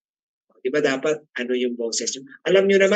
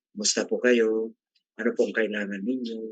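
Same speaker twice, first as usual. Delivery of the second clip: answering the phone low and softer, at the bottom of the range; 5 semitones lower, 5 dB quieter than usual. Shown as a demonstration. Teacher demos a phone greeting at low do, pitched low. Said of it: "Pag nandiyo, do".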